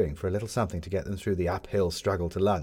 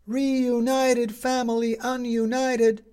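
high, slow street-hawker cry; newsboy crying the headline to passing crowds; newsboy shouting; 5 dB louder, much slower than usual. A narrator's reading. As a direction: call it out like a paper boy selling headlines.

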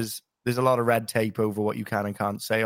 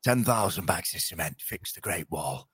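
Game of Thrones voice; 5 semitones lower, 4 dB quieter than usual. Retold or imitated. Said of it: "you could go back to the Game of Thrones".

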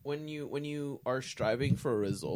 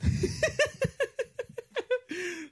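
high laugh; 6 dB louder than usual. Real, not acted. Laughing after bit.

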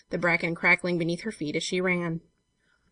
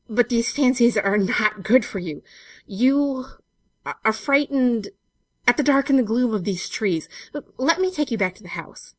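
halting, raised, shaking voice; shaken voice; 5 semitones higher, 5 dB louder than usual. A narrator's reading. Benson urging her back to the house, voice shaking. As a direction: push out the words haltingly, unnerved. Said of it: "and his voice shook".

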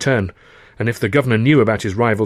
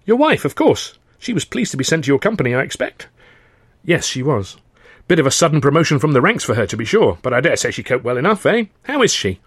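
high, breezy upper-class chatter; chatty young toff; 4 semitones higher than usual. Acted. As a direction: play a cheerful, eager young gent. Freddie voiced in dialogue.

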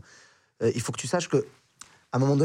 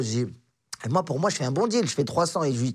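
ordinary talk

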